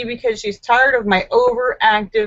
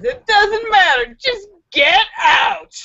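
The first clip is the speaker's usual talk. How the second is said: mock shout; 10 semitones higher than usual. A narrator's reading. Wife kicking husband out.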